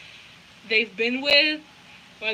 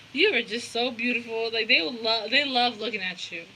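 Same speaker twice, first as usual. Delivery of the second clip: usual speaking voice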